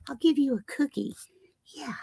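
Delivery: soft whisper to the dog; whispered bribe. Real, not acted. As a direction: whisper the promise of a treat. Bribing dog with cookie.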